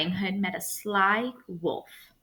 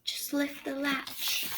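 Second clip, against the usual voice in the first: slow, much softer, higher frail voice; frail grandmother; 6 semitones higher, 10 dB quieter, slower than usual. Acted. Grandmother invites the visitor in, frail and slow. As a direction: breathe it out weakly from bed.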